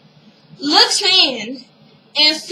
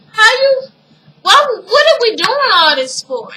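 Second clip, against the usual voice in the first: high, louder incredulous outburst; retold disbelief; 8 semitones higher, 4 dB louder than usual. Hakeem questioning his father's news, in high disbelief. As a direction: imitate someone protesting in disbelief, pitch jumping up.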